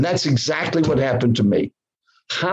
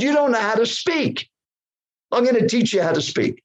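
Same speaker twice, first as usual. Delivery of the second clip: higher-pitched mock scolding; retold yell; 7 semitones higher than usual. Critic telling a novice he'll teach him, mock yell. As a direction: imitate the man still yelling, pitch well up. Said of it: "he yelled at me".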